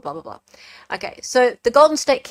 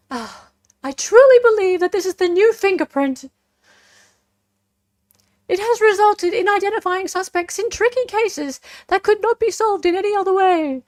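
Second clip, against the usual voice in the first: higher, louder put-on official voice; generic prosecutor's voice; 11 semitones higher, 5 dB louder than usual. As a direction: read it like a confident prosecutor. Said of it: "generic prosecutor's voice".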